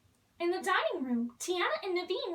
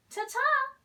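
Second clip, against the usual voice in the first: high sing-song farewell; high, bright ta-ta called out; calling goodbye; 8 semitones higher, 4 dB louder than usual. Acted. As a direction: call out a cheery goodbye as you leave. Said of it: "Charlotte called as they left".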